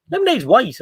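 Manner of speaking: imitated barman, high-pitched protest; mock disbelief, pitched well up; baffled barman objecting. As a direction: correct the customer, utterly puzzled.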